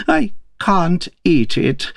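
high, drawn-out tearful wail; mildly acted tearful complaint, slowly. A narrator's reading. Crying Queen complains to the King over her food, tearful. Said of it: "The King heard her sobbing".

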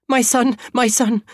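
high, panicked cry; frantic mother. A narrator's reading. Mother calling the police about her son, frantic. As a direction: plead in a high, distraught voice.

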